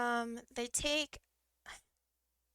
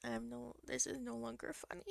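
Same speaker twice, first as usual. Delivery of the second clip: softer, glum retelling; deflated quiet; 6 dB quieter than usual. Retold or imitated. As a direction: let the fun drain out. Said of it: "it turned from me laughing".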